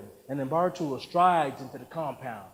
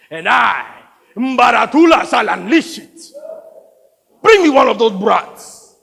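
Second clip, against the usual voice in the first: booming, grandiose threat; menacing warlord; 11 dB louder than usual. Acted. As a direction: proclaim your savage power, building to shouts. Plays the warlord.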